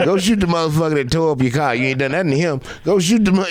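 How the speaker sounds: a friend chewing him out, hammed up; mock scolding friend. Friend scolding, after a laugh.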